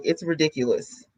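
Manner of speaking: ordinary delivery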